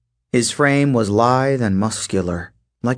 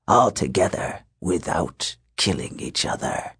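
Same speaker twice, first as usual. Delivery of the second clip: low wolfman voice; 6 semitones lower, 6 dB quieter than usual. Acted. Wolfman musing on the group.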